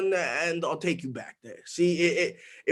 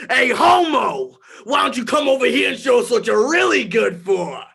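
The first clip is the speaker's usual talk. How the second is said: loud, jeering call across the street; taunting shout; 4 semitones higher, 6 dB louder than usual. Retold or imitated. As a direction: yell a mocking taunt at him.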